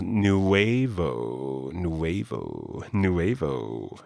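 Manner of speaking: "nuevo" dragged as noo-way-vo, dropping low and soft; three syllables. Shown as a demonstration. Teacher showing learners the mistake, low three-syllable "nuevo". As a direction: drone a wrong three-beat "nuevo". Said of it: "say it in three syllables".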